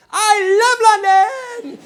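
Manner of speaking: high, loud, drawn-out cheer; mock enthusiasm. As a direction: imitate an overexcited tourist rallying a whole crowd. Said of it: "where enthusiasm is a sign of weakness".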